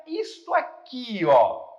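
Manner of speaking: usual speaking voice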